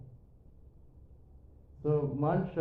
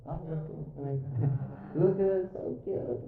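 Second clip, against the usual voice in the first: higher-pitched mock cooing over a baby; cooing parent; 4 semitones higher than usual. Parents showing baby pictures to visiting friends, mock cooing. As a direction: imitate a doting parent, pitched up.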